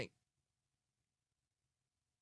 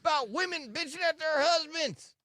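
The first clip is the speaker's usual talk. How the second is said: angry high rant; 12 semitones higher, 3 dB louder than usual. Pretend. Mock rant as Nard.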